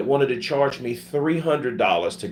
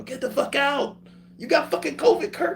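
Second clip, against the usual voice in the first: imitating shocked friend. Friend reacts to covid news.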